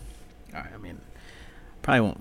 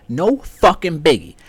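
high, loud sarcastic outrage; sarcastic rant; 11 semitones higher, 7 dB louder than usual. Real, not acted. Ranting about Trump running again, high and loud. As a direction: rage at the hypocrisy.

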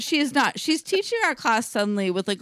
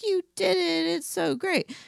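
put-on older lady voice; 8 semitones higher than usual. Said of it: "they're like".

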